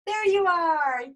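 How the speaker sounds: loud, high burst of delight; delighted greeting. Real, not acted. Host seeing her friend finally appear on screen, real delighted cry. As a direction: call out joyfully at spotting someone.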